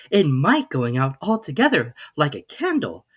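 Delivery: put-on a girl wondering aloud; lightly voiced Alice. A narrator's reading. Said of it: "said Alice to herself".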